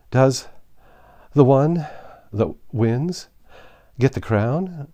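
high, broken into gasped phrases; out of breath. A narrator's reading. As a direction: ask haltingly, pausing for breath every word or two. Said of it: "as well as she could".

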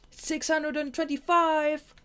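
high, loud, slow called-out score; hollered. Acted. Shade calling his score past Marina, high loud holler. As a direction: shout it across the sky mid-flight. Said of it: "he hollered".

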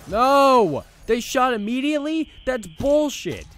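loud, high furious outburst; outraged yell. Real, not acted. Player raging as enemies shoot instantly, furious loud yell. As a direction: blow up at the game, slowing on bullshit.

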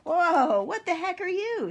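loud, high startled yell. Acted. Cowboy seeing his friend's scary costume, startled. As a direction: burst out in high-pitched alarm.

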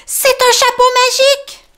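high, loud marvelling mole; Tom delighted. Acted. Tom to Grumbo about the hat, high delighted voice.

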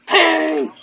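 loud, high yelp of pain; mock pain cry. Playful cries when hurt in game.

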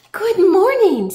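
high, loud, drawn-out cheery greeting; big friendly storybook hello, pitched high; friendly ladybug. A narrator's reading. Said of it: "said the friendly ladybug".

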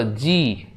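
জি with its "ee" held and falling; drawn-out জি. Shown as a demonstration. Teacher showing pupils the vowel in the letter, জি drawn out. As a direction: stretch the vowel that ends জি. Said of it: "ই উচ্চারণ হচ্ছে".

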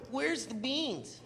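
drawn-out, very slow mocking call; slow sly heckle. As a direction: stretch it out slowly like a sneaky heckle. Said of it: "I would go".

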